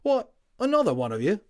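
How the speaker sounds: a WWI orderly waking up chatty; friendly Cockney Tommy, loud and high; cheery Cockney medic. Acted. Tommy surprised at Patrick.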